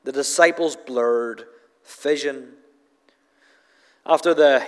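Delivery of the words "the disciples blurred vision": "The disciples' blurred vision" said slowly, each word set apart; slow repeat for emphasis; slow, deliberate repeat. Shown as a demonstration. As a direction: lean on "disciples'" and let "vision" fall. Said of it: "But I want you to see now".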